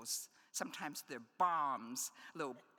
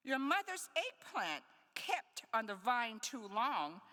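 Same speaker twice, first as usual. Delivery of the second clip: poem reading; 4 semitones higher than usual. Acted. Poet to audience, reading the fruit etudes. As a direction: give each fruit name its own weight.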